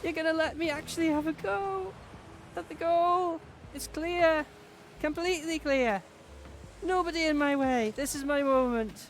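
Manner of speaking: high, gushing voice swelling toward the goal; emotional inner gratitude. Retold or imitated. Imagining the team cleared the way for her, high and thrilled. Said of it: "in my head I was like".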